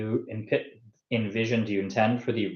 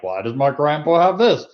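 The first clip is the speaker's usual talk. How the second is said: puzzled grandkid; 4 semitones higher, 6 dB louder than usual. Pretend. Voices puzzled grandkid finding the book.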